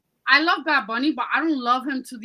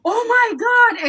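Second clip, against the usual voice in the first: high, loud gasping oh my god; imitating a friend freaking out, pitched way up; mock freakout; 11 semitones higher, 7 dB louder than usual. Retelling how her friend panicked on FaceTime, high and loud. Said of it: "basically said".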